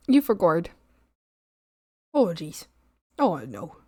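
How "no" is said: ordinary talk, nothing marked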